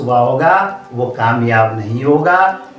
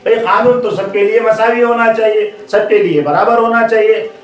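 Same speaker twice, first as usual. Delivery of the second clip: higher-pitched, indignant complaint; indignant protest; 7 semitones higher than usual. Acted. Other monkeys protesting to the leader, indignant. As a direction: object as a wronged crowd demanding equal treatment.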